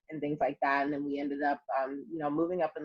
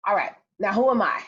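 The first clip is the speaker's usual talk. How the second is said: usual speaking voice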